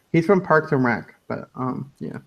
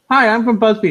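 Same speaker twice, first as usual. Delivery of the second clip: higher, louder imitated reporter introduction; mock eager reporter; 8 semitones higher, 5 dB louder than usual. Retelling a reporter at the press conference, mock-eager voice. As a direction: pipe up high like a sketch reporter. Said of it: "he's like".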